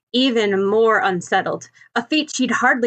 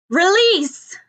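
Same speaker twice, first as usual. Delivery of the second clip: higher, louder call from up high; 9 semitones higher, 3 dB louder than usual. Acted. Bakugo on the rooftop calling down to Ochako, loud command. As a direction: shout the command down from the roof.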